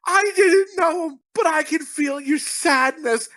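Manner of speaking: high, wailing imitation of a tearful teacher; hammed-up sobbing voice, slowing at the end; mock crying. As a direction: imitate someone blubbering in grief. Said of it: "And she's crying".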